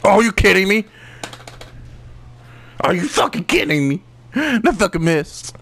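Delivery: high-pitched, indignant gamer outburst; exasperated disbelief. Real, not acted. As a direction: protest the miss, voice jumping up high. Gamer protests a missed hit.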